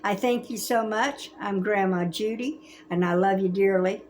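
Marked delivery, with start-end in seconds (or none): none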